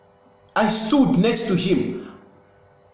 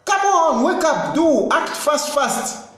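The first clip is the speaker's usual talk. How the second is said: urgent call; 6 semitones higher, slower than usual. Real, not acted. Urging the police to act.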